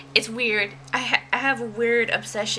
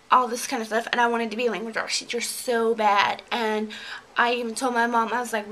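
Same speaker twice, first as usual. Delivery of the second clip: ordinary delivery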